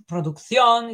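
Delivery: "producción" with Spanish vowels and stressed last syllable; pro-duk-THYON, stressed at the end; Spanish pronunciation. Retold or imitated. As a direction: say "producción" the Spanish way as a wrong example. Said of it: "it's not produc".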